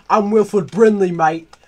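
mock British lad picking a fight, high and loud; loud mock British pub-brawl challenge; mock British shouting. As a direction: bellow like a rowdy British yob, "mate!". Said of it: "I'm British".

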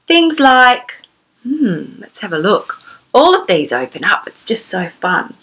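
ordinary delivery, nothing marked

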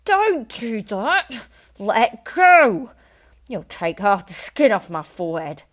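loud, slow pained shouting; screaming protest. A narrator's reading. Husband shouting at his wife to let go. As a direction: yell at her to let go. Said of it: "he screamed".

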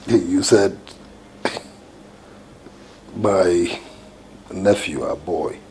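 stunned disbelief. Acted. Spouse repeating that the nephew called, halting disbelief. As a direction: piece the news together with long pauses.

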